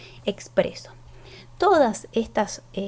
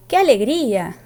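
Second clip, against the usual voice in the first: ¡Qué alegría! exclaimed, louder; exclamation; 3 semitones higher, 7 dB louder than usual. Shown as a demonstration. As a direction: burst out ¡Qué alegría! with joy. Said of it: "si yo hago una exclamación". (exclamación alegre y fuerte)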